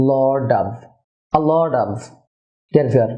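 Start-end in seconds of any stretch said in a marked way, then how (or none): 0.1-1.9 "a lot of" run together as "a-lod-av"; linked pronunciation. Shown as a demonstration. Teacher models for viewers, "a lot of" linked with D for T. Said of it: "তাই এর উচ্চারণ হয়েছে".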